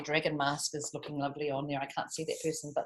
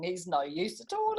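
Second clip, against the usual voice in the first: usual speaking voice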